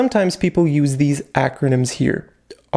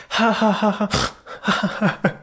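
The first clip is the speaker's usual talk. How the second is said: higher ha ha ha, mock hearty laugh; loud laughter demo; 6 semitones higher, faster than usual. Shown as a demonstration. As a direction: act out laughing really loud. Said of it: "If you're laughing really loud".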